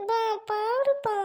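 high, very slow whine; drawn-out plea to Dad; whimpering appeal. Acted. Scolded child appeals to father. (இழுத்துச் சிணுங்குவது)

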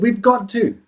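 high, loud desperate plea; urgent plea. A narrator's reading. Boy begging Kensuke to light the signal fire, loud and high. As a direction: insist with rising panic.

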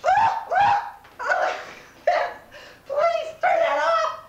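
high, weepy, breaking voice; upset, tearful. Acted. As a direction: wail it out, half crying, half laughing. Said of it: "back to Gene Bank".

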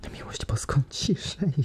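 high, frightened whisper; trembling whisper. Acted. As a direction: hush them in terror. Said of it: "wyszeptała drżącym głosem Krystyna".

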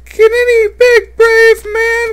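piercing falsetto damsel, wailing; hammed-up falsetto helpless noob; helpless girl voice. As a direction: play the lost damsel in distress. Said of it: "I gotta change my voice".

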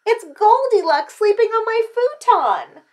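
high, loud discovery; storybook child's outcry; outraged child. A narrator's reading. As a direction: tattle like a little kid. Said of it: "said little Chan".

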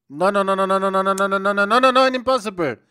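rapid-fire loud string of refusals; machine-gun nos climbing into a cry; frantic rattled protest. Real, not acted. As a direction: rattle it off, rising at the end. Panicked refusal during game action.

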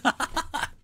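a sudden high-pitched laugh; high burst of laughter. Real, not acted. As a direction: let out a high squeal of a laugh. Laughs at his own joke.

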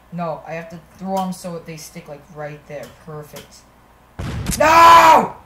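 ordinary delivery

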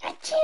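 a real sneeze; sneezing. Real, not acted. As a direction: sneeze sharply. Sneeze sound.

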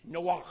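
Noach again with a rasping throaty "ach"; Hebrew Noach. Shown as a demonstration. Teacher repeating the Hebrew Noach for the Sunday school class, throaty. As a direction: clear the throat on the end of Noach. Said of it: "like in German where it sounds like you're throwing up".

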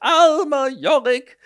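high, loud, slow tragic sigh; milk it like a Hamlet soliloquy; an overwrought actor sighing Yorick's name; theatrical lament. Acted. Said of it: "das sagt er ständig".

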